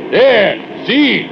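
high, urgent pointing-out yell; hollering. Acted. Worker directing the foreman's eye downward, high holler. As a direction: shout for him to look. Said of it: "and I holler".

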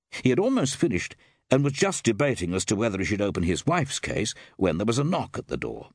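usual speaking voice

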